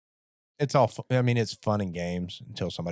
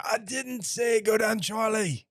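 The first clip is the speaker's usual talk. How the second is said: much higher, slow outraged controller shout; mock angry controller; 12 semitones higher, slower than usual. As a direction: yell at the pilot, appalled.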